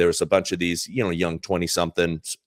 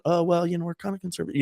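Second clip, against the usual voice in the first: sheepish; 10 semitones higher, much faster than usual. Retold or imitated. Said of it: "a little sheepish".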